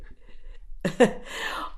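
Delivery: short breathy laugh; amused burst of laughter. Real, not acted. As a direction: laugh at yourself briefly. Laughing at her own off-key singing.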